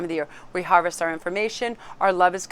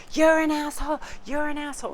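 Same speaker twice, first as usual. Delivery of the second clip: high-pitched mock outburst; imitated popping off; 8 semitones higher than usual. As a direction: mimic a hot-head lashing out. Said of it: "popping off on everybody going".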